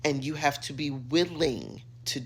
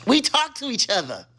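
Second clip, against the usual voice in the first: big reveal; 9 semitones higher, 8 dB louder than usual. Real, not acted. Reveals the secret after whispering.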